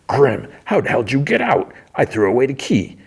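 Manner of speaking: high-pitched, acted-out outburst; alarmed disbelief. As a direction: demand answers in mock shock. Sweet Tooth shocked by escaped rival.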